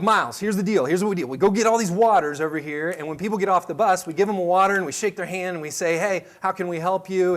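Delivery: put-on walk the kid through the plan with energy; coaxing pep talk. Dad coaching his nervous son at the greeting job, upbeat instructions.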